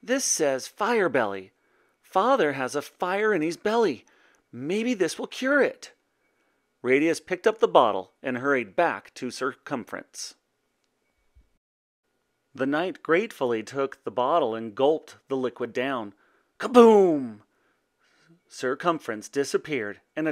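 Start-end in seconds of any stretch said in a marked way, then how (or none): 0.0-5.9 put-on lilting, higher young voice; hopeful pondering. A narrator's reading. Boy finds a bottle for his father, hopefully pondering. 16.6-17.4 high, loud falling boom; explosion word. A narrator's reading. Narrator as the potion explodes, booming.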